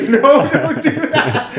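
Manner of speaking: very high, squealing panicked plea; retold scream. Caller begging the agent not to hang up, mock screaming. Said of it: "I'm screaming".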